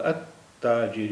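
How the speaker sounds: التاجر with silent lam and doubled ت, said slowly; slow teaching demo of التاجر, lam silent; slow demo of al-tajir. Shown as a demonstration. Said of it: "آدھا تا ہے پھر پورا تا ہے".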